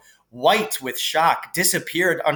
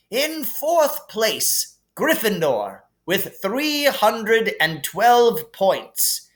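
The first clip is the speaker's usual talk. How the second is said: Dumbledore voice; 8 semitones higher than usual. Acted. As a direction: announce the points like a wise old headmaster. Dumbledore announcing House Cup points.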